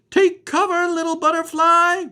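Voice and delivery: high, loud urgent warning; eel warning. Acted. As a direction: call out a storm warning. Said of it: "warned the eel".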